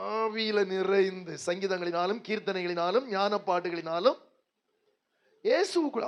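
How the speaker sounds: normal talking voice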